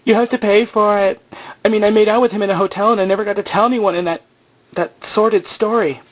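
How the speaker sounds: high, loud imitation of an outburst; hammed-up high-voiced rant; mock hysterical woman. Parody of Shannon's armed outburst.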